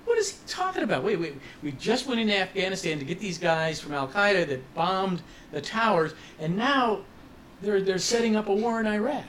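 high-pitched, baffled public reaction; incredulous. Retold or imitated. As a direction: imitate people who can't believe what they're hearing. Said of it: "scratching their heads incredulously going".

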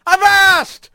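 high nautical warning cry; high, ringing shout; sailor's yell. A narrator's reading. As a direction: yell it like a deckhand. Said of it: "someone yelled".

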